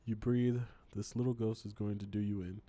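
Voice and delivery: normal talking voice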